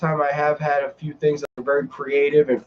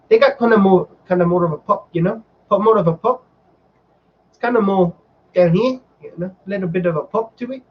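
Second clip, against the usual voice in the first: higher-pitched New Zealand impression; New Zealand accent; 4 semitones higher, faster than usual. Shown as a demonstration. Vlogger showing off accents for viewers, New Zealand accent. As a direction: try out Korg's popping New Zealand accent. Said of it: "like, in New Zealand".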